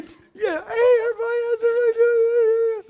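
high, bright mock-cheery hello; fake cheerful greeting. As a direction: ham up a fake happy church greeting.

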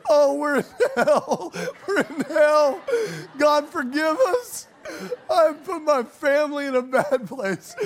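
high, loud, half-crying wail; hammed-up lament, laughing through tears; retold panic in bed over coyotes; mock despair. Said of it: "I'm like".

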